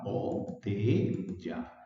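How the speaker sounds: "botella" very slowly split as bo-te-lla; low, slow "botella" with gaps between bo, te and lla; broken into syllables. Shown as a demonstration. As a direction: say "botella" in three separate, drawn-out syllables. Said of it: "botella tiene tres sílabas".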